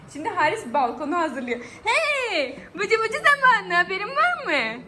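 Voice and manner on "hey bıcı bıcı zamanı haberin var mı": excited call. Real, not acted. Mother cheerfully calling baby to bath.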